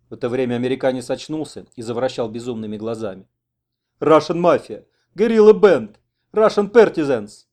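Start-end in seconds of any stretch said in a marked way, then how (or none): 4.0-7.5 loud, high-pitched panicked shouts; crazed yelling. Acted. Wounded American comes to and accuses his rescuers, crazed yelling. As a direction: yell wild accusations.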